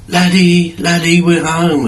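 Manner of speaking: high, loud eager calling out; excited kids calling. Retold or imitated. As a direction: call the dog like happy kids bursting in. Kids burst in calling dog.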